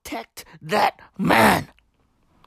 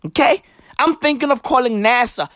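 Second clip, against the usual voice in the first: ordinary delivery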